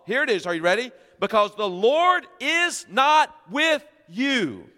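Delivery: high, loud, slow warning; stern rebuke. Acted. Moses rebuking the Israelites, loud and high.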